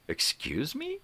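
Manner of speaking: high, sharp indignant rise; indignant excuse me. Retold or imitated. Snapping back at a rude biker, indignant. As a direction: act offended and incredulous. Indignant retort to being told off.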